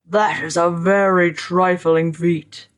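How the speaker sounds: slow, boastful monster voice; haughty spirit. A narrator's reading. Spirit boasts to the doubting scholar, haughty voice. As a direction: dismiss it grandly as a mighty spirit. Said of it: "The spirit said haughtily".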